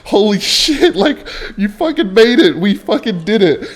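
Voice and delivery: high, thrilled cheer; excited relief. Retold or imitated. Cheering at her friend who made the flight. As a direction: celebrate like you just made it. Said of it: "I'm like".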